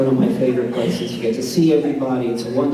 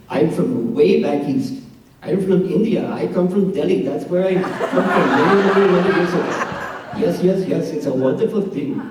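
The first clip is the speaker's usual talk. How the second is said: put-on Indian accent; 5 semitones higher than usual. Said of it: "I have a New York accent".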